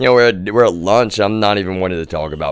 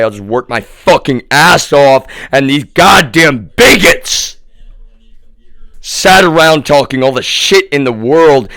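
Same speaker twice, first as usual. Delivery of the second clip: building from loud to a shouted, much higher, cracking cry; furious rising rant; 9 semitones higher, 9 dB louder, slower than usual. Retold or imitated. Venting at bigots who broke his tech, a furious breaking shout. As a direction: rant harder and harder until the voice breaks.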